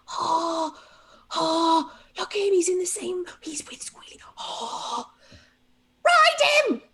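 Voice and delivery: high, hushed, tentative goblin voices; timid goblin crowd. Acted. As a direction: whisper it nervously, then cry ride him. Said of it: "the crowd of goblins ... tentatively like".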